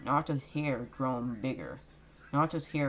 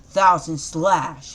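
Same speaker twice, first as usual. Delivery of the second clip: slow, stretched battle cry, much louder; attack shout; 3 semitones higher, 12 dB louder, much slower than usual. Acted. Naruto calling out his sword attack, long loud shout. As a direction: bellow the technique name slowly.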